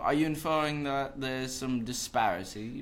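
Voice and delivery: posh-sounding British accent put on. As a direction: play at sounding British and clever. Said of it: "that sounds so smooth".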